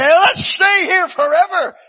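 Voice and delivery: put-on high, loud childlike plea to stay; eager plea. Eaglets begging their parents to stay, high and eager. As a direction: beg eagerly to stay forever.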